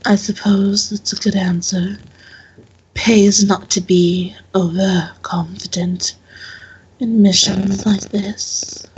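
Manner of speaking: slow, measured, lightly voiced guardian; grudging approval in a lightly stern character voice; stern temple questioner. Temple woman accepting the visitor's answer, slow and mildly stern.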